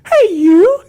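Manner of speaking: put-on very high, creepy old man; whistly falsetto old codger calling out; Herbert. Old man calls for newspaper, high creepy falsetto. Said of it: "you wanna hear Herbert".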